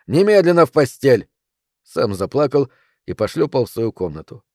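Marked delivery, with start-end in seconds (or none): 0.1-1.3 high, loud angry order; yelling. A narrator's reading. Father ordering his son to bed, yelling. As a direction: shout him off to bed. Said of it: "заорал он".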